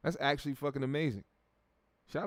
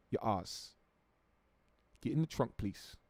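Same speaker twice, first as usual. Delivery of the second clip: softer London kidnapper impression; mock London accent; 7 dB quieter than usual. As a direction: drop the volume, still a comic London gangster. Mock London kidnapper gives orders.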